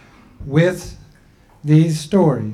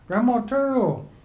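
a higher, slightly raised voice for the people; people's voice; 8 semitones higher than usual. Acted. The people telling Grandma Turtle she's too old, in a raised voice.

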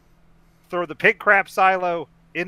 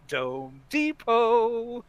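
slow, stretched, higher announcer voice; drawn-out prank call; 8 semitones higher, much slower than usual. Pretend. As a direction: stretch it out like a silly store greeting. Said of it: "get him on the phone and be like".

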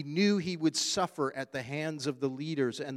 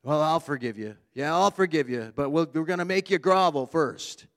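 mock grudging forgiver; 5 dB louder, faster than usual. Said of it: "We like people to grovel".

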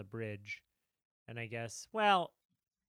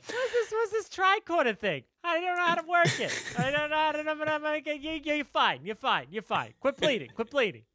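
high, loud panicky ditz; flustered doctor voice; 11 semitones higher, 6 dB louder than usual. Acted.

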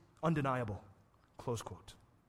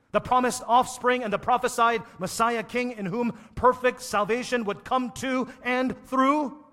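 loud, high cry of my God; fervent preaching; 7 semitones higher, 10 dB louder than usual. Acted. Preacher crying out to the congregation. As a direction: cry it out at the top of the voice.

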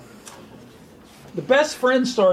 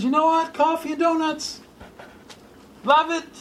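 light, higher, playful Jesus; lightly voiced Jesus; 10 semitones higher than usual. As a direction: tease it gently, a bit higher. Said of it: "But Jesus says".

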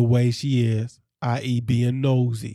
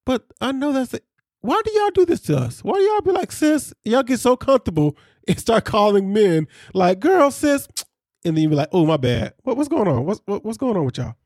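much higher, hammed-up pleading rant; exasperated mock rant; 7 semitones higher than usual. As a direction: squeal it out in mock disbelief. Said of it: "And I'm like".

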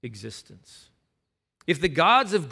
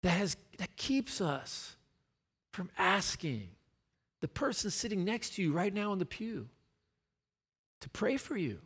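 retold wondering question; 4 semitones higher, 5 dB quieter than usual. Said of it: "I was like".

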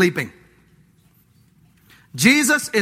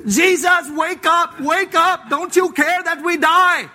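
frantic plea; 6 semitones higher, slower than usual. Retold or imitated. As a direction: yell to wake him up. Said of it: "they have to take it to the next step".

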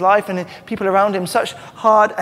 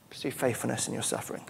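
soft repeat; 5 semitones lower, 12 dB quieter, faster than usual. Shown as a demonstration. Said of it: "Or you could say".

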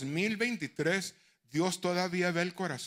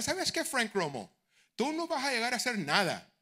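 lightly voiced scornful teacher, pitched higher; mocking teacher; 6 semitones higher than usual. Preacher mimics his teacher's put-down. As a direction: sneer it like the old teacher.

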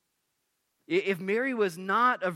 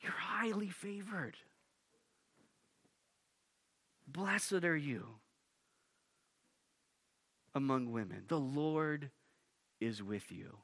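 softer, slowing, falling away; soft awed proclamation; 3 semitones lower, 8 dB quieter than usual. Acted. Gabriel speaking softly to Mary. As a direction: let it hush to a reverent close. Angel's words, then softening.

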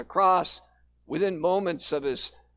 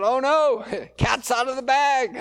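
laughing dismay; 6 semitones higher, 4 dB louder than usual. Retold or imitated. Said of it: "I thought".

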